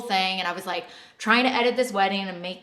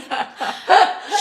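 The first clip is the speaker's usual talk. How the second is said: high, loud teary laugh; real teary laugh; tearful laugh; 11 semitones higher, 11 dB louder than usual. Real, not acted. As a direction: laugh through tears. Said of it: "I'm, like, crying".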